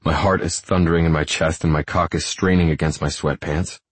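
usual speaking voice

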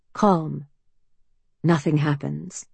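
ordinary delivery, nothing marked

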